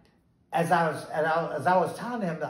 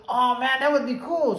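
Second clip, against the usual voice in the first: high, keen retold yes; light up at a buddy's offer; delighted acceptance; 8 semitones higher, 5 dB louder than usual. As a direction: enthusiastic and pitched up. Acted glad acceptance of the offer.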